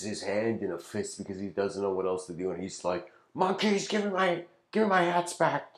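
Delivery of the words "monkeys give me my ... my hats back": high, loud helpless shout; retold shout at monkeys. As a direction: yell up at the thieving monkeys, much higher. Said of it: "he shouts something".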